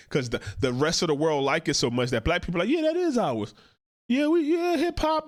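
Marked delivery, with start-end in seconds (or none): 2.7-5.3 high, smug mock voice; sarcastic high-voiced boast; mocking claim. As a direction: pitch it way up and gloat. Mocking people claiming gang culture.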